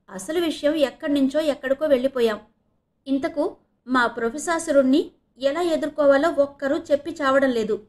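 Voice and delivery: complain, fed up with everyone; mildly exasperated. A narrator's reading. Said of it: "విసుక్కుంది". (కాస్త విసుక్కుంటూ)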